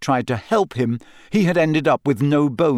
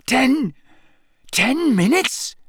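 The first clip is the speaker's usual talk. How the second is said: higher, slow, shocked croak; panicked croak; 11 semitones higher, slower than usual. A narrator's reading. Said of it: "Harry croaked".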